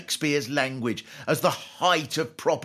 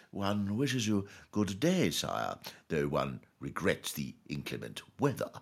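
mock medieval nobleman, hushed; old courtly English; 7 semitones lower, 8 dB quieter than usual. A commoner wishing good day in old English, courtly and hushed. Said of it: "heard someone saying".

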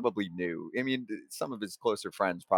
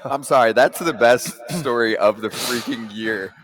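laughing; 6 dB louder than usual. Real, not acted. Host cracking up at story.

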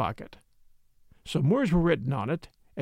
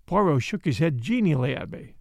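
ordinary delivery

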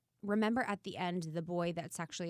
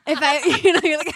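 laughing while talking, higher and louder; 10 semitones higher, 14 dB louder than usual. Real, not acted. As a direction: giggle through the words. Laughing through the retelling.

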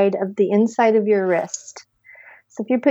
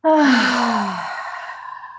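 voiced sigh. Shown as a demonstration. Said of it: "make some sound".

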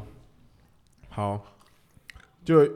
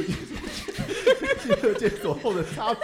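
breaks into high laughter mid-line; cracking up; 9 semitones higher than usual. Real, not acted. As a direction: lose it laughing at the punchline. Cracks up at own punchline.

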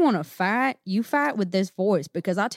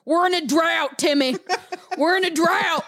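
higher, lightly voiced dad; a hint of a scolding father; dad scolding Timmy in a mock voice; mock stern dad; 9 semitones higher than usual. Acting the dad's reply.

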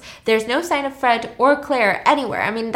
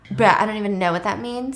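ordinary delivery, nothing marked

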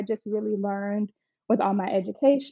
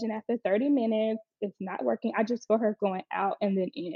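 normal talking voice

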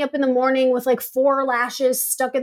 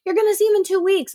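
high, fast chirpy imitation; high, quick cheerful reassurance; imitating friends; 9 semitones higher, faster than usual. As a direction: rattle it off like a chipper friend, pitched up. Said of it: "other people too are like".

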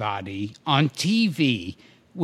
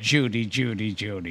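Cary Grant impression; 7 semitones lower than usual. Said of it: "it's Larry Storch that said".

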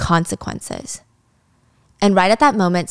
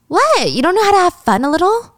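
mocking disbelief; 9 semitones higher than usual. Chrissy high, mocking Ari.

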